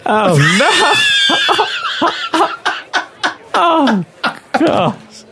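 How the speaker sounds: high, breaking up with laughter; real laughing dismay. Real, not acted. Actor fluffs the carrot line and cracks up, high laughing. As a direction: lose it laughing mid-line.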